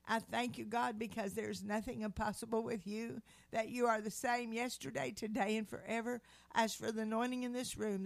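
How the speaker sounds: loud, earnest prayer voice; fervent prayer. Acted. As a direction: pray aloud with full conviction. Opening prayer before the talk.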